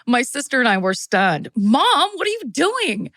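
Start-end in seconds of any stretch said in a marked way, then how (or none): 1.5-3.1 alarmed yell. Retold or imitated. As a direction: yell it in disbelief at mom. Stunned teens yelling at mom.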